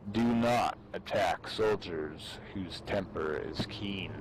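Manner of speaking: ordinary talk, nothing marked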